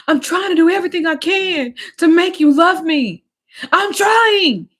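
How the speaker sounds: high, loud, crying plea; imitating crying plea. Boyfriend crying to her to make her love him, high tearful plea. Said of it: "he was crying".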